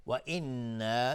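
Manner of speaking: slow «و انا» with the doubled noon hummed for two counts; ghunna on the noon. Shown as a demonstration. Teacher models ghunna for students, noon of «و انا» held and hummed. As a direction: hold the noon of «و انا» as a nasal hum.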